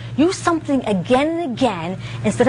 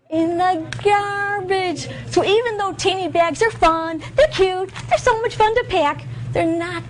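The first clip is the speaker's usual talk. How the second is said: sing-song teacher; 8 semitones higher than usual. Shown as a demonstration.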